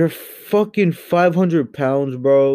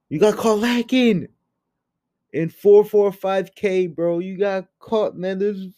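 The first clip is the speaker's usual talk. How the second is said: outraged disbelief; 9 semitones higher than usual. Real, not acted. A vlogger ranting at his former favourite YouTuber, outraged. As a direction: vent your disbelief at a fallen idol.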